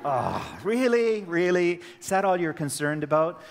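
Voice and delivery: high, loud mock disbelief; mock outrage. Teacher mocking a student's test question, in loud high mock outrage. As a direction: play up being scandalized at the question.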